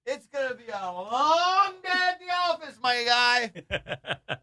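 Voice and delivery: high, drawn-out comic lament; mock dismay. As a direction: stretch it out in a high wail. Acted dismay at friend already winded.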